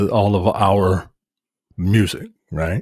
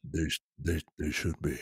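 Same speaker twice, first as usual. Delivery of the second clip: very low, soft murmur; mock-seductive low voice; 9 semitones lower, 14 dB quieter than usual. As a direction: drop it way down and quiet. Low replay of co-host's flirty line.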